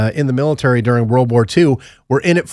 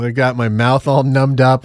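normal talking voice

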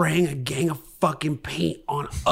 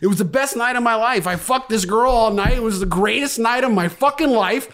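emphatic retold speech; 6 semitones higher, 5 dB louder than usual. Telling police his meth story.

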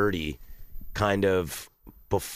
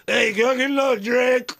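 put-on drunk bar patron; 4 dB louder than usual.

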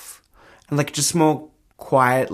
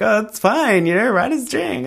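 mock breezy excuse; 10 semitones higher than usual. Mocking past self excuse.

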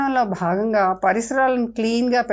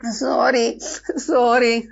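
tearful sorry; much slower than usual. A narrator's reading. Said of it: "అంది మోనా కన్నీళ్లతో".

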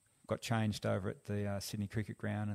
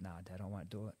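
quiet refusal; 3 semitones lower, 9 dB quieter than usual. Retold or imitated. Son refusing his dad.

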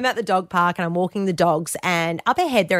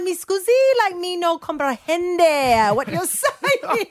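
put-on high, slow comic foreign accent; badly faked European accent, breaking into laughter; mock foreign accent; 10 semitones higher, slower than usual. Baffled eavesdropper addressing French speakers, laughing mock foreign accent. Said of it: "Is that French?".

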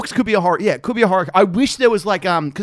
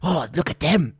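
hungry orc eyeing prey in a put-on voice; orc impression; 4 dB quieter than usual. Orc spotting the hobbits as food, creature voice. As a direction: voice a greedy orc. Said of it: "and then one guy's like".

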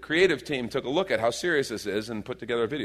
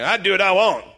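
loud, higher defiant shout; defiant catchphrase; 7 semitones higher, 7 dB louder than usual. Retold or imitated. Quoting son's friend's catchphrase, loud mock defiance. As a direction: call it out like a rebellious kid. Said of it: "always was saying this thing".

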